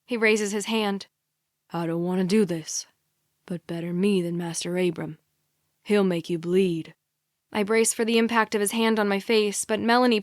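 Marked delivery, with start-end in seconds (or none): 1.7-6.9 low-pitched, controlled menace; threatening captor. A narrator's reading. Captor about to hit the captive, low warning. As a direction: lower the voice into a reluctant threat.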